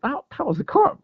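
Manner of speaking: half-retold thrill at spotting a carp; excited realisation. Angler sees a fish show on a dead lake, mock excited.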